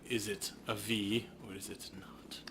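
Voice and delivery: hushed conspiratorial whisper; low secret question. Real, not acted.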